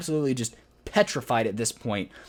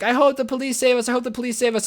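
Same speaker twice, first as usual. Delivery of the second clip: high-pitched mock whimpering plea; crying little girl; 8 semitones higher than usual. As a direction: imitate a crybaby begging for rescue. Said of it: "being the crying little girl he is".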